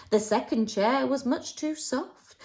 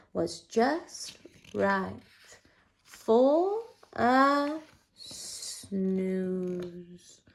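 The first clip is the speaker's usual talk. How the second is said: slow breathy sleepy murmur; sleepy whisper; 3 semitones lower, 4 dB quieter, much slower than usual. A narrator's reading. Narrator to children, whispering Goldilocks off to sleep. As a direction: drift off into a whispered yawn.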